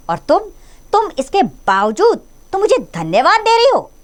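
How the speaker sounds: very high, loud, shaking with anger; nearly yelling in outrage; almost shouting. A narrator's reading. Master nearly shouting at the maid in outrage. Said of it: "ऊंचे स्वर में लगभग चिल्लाते हुए".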